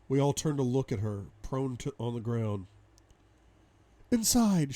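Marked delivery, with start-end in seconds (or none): 4.1-4.7 high-pitched woman's voice. A narrator's reading. Captive woman on the ground, high urgent reply. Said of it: "she says".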